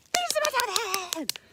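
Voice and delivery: high, very loud yell; climactic shout. A narrator's reading. Storyteller reaching the bunny's attack on the mouse, climactic yell. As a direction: belt out the climax at full volume.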